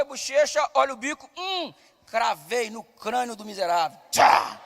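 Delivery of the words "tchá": usual speaking voice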